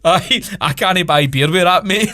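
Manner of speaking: put-on German accent cracked up with laughter; mock German accent. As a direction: play the German producer in a jokey German accent, laughing. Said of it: "my German accent wasn't coming across there".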